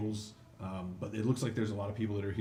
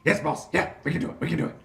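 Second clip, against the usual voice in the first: high, eager yapping lackey; high, loud, eager gnoll yapping agreement to the boss; yipping gnoll; 9 semitones higher, 9 dB louder, faster than usual. Acted. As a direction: yip it out like an excited little dog-man. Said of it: "yipping and barking".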